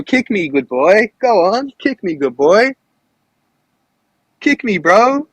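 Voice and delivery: teasing taunt to the host. Real, not acted. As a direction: goad him like a dog. Taunting opponent to kick him.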